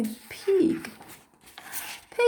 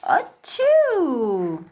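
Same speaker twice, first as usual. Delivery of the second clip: big sneeze. Acted. Gerald sneezes at Piggie.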